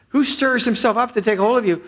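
high, pleading voice reading the lament. Acted. Pastor reading the verse to the congregation, high and pleading.

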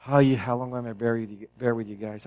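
low, soft, imagined sigh of exasperation; weary exasperation. Pretend. Preacher imagining Jesus looking down on church, mock weary. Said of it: "look down at Horizon Church here in Fitchburg and go".

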